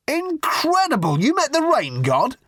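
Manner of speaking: astonished. A narrator's reading. Journalist stunned that Arthur met the Rain God. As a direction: gasp it out in disbelief.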